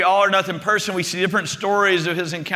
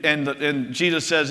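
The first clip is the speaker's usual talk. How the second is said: usual speaking voice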